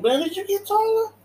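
put-on butter the kid up; flattering voice. Man flattering the boy, high mock sweetness. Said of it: "talking like".

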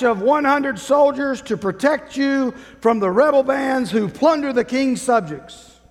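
loud, high royal proclamation; town crier. Acted. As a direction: shout the king's decree to the crowd. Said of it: "I'll scream anyway".

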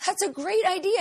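very high sing-song mimicry; mocking high voice. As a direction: gush like an excited woman. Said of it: "It's like".

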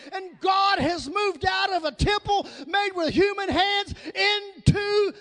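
impassioned shouted sermon, driving phrase by phrase; rousing pulpit cry; full-force preaching. Real, not acted. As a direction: preach it hard, leaning on each key word.

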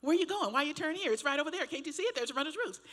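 high, exasperated rapid questions; nagging backseat driver. Retold or imitated. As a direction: pester the driver, pointing at the store. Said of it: "here I go again".

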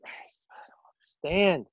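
breathy exasperated whisper. Real, not acted. Fan despairing over the switch, whispered. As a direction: hush it in disbelief.

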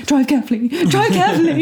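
high-pitched retold plea, rising on the repeat; mock-fretful begging, pitched well up; anxious plea. Retelling her anxious plea to driver.

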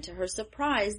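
ordinary delivery

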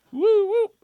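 high, loud mock siren whoop; police siren whoop. Telling friends about getting pulled over, siren imitation.

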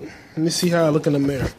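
ordinary talk, nothing marked

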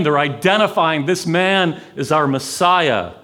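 normal talking voice

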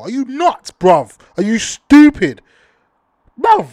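loud, high, furious yelling at him; angry outburst. Real, not acted. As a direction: shout at him in disbelief, slowing on stupid. Ranting at the tweeter.

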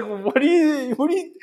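high, laughing half-question; laughing disbelief. Retold or imitated. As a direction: stammer it out through giggles. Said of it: "I was just like".

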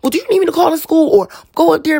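high-pitched, loud, fast outrage; shocked mom ready to storm the school; mother hears her child was insulted and reacts in loud, fast disbelief. Retold or imitated. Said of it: "I was like".

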